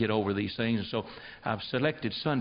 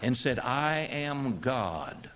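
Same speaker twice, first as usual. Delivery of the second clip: slow retold quote; much slower than usual. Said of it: "And he said".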